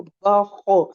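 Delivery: full-mouthed, drawn-out kh in बखो; heavy kh. Shown as a demonstration. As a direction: pull the tongue up and back on बखो. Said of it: "टंग को shrink करके उठाएंगे".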